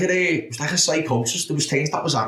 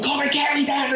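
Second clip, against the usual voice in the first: mock shout; 13 semitones higher than usual. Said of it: "I was going".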